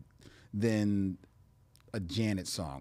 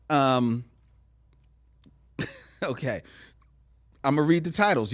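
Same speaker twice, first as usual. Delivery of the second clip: ordinary delivery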